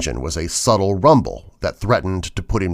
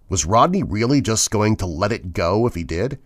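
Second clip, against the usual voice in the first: usual speaking voice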